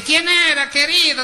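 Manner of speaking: husband calling from inside the house, high and loud. Retold or imitated. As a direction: shout the question through the house.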